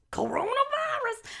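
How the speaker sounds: "Coronavirus" sung out very high and slow; high silly voice. Pretend. Repeats word in mock voice.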